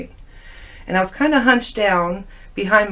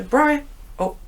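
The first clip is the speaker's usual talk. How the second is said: startled scolding; 7 semitones higher than usual. Retold or imitated. Scolding friend in dream.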